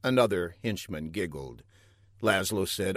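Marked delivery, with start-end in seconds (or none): none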